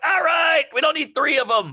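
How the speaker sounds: high, loud fed-up shout; exasperated shout. Retold or imitated. Audience member shouting at a comic to stop repeating. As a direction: yell at him to stop repeating. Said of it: "And you're like".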